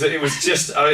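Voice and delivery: usual speaking voice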